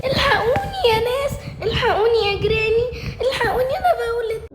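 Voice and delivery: high, wailing labour plea; panicked cry for help. Acted. A woman in labour begging neighbours for help, panicked.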